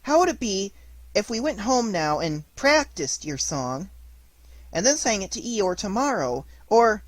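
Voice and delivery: timid. A narrator's reading. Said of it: "a little timidly".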